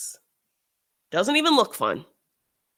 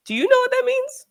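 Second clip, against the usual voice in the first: incredulous question; 5 dB louder than usual. Acted. Challenging the joker.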